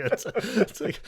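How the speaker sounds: normal talking voice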